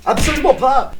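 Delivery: shouted denial; loud. Real, not acted. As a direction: belt it out loud and high. Answering his own question.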